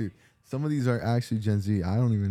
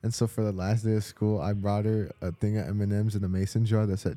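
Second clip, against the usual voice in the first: ordinary delivery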